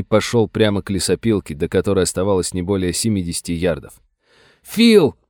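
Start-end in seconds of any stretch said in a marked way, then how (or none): 4.6-5.2 very high, loud cry of the name; desperate cry. Acted. Sam crying out to Phil, desperate shout. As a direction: scream it as he walks into fire. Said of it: "отчаянно крикнул Сэм".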